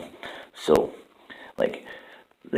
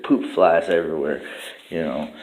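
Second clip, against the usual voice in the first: ordinary delivery, nothing marked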